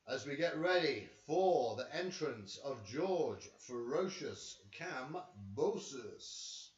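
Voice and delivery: slow, drawn-out ring announcer call. Acted. Vlogger announcing Kambosos's entrance like a ring announcer. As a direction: stretch the name like a ring announcer.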